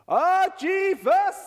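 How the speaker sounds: big slow announcer shout. Acted. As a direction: boom it out like a game show host. Announcing the show title.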